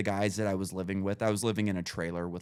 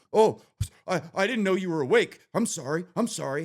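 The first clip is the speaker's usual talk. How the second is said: high, flustered sorry; flustered apology; 9 semitones higher, 6 dB louder than usual. Retold or imitated. Roommate caught in the act, apologizing flustered. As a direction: stammer it out, caught red-handed. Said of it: "And this guy's like".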